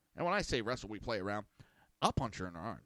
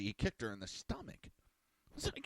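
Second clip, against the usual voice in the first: usual speaking voice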